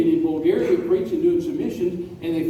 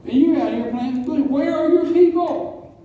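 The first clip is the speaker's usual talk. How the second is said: high, louder preaching rebuke; indignant challenge; 9 semitones higher, 4 dB louder than usual. Retold or imitated. Teenager confronting a pastor at his church, indignant challenge.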